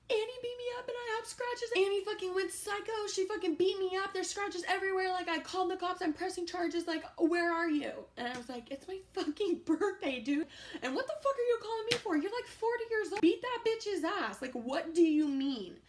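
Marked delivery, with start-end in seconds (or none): 0.1-7.9 high-pitched mock hysterics, words tumbling out; imitated hysterical caller. Storyteller voices hysterical roommate calling about Annie, high mock hysterics. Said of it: "she's hysterically crying". 8.7-15.8 high, indignant, exasperated comeback; retold mad retort. Storyteller replays birthday phone retort to roommate, high-pitched and mad. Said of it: "This gets me so mad".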